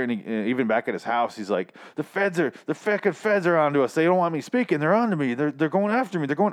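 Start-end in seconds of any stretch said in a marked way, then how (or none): 1.9-6.5 higher-pitched mock paranoid rant. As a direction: imitate a drug dealer sure he's hunted. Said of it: "getting very, very paranoid".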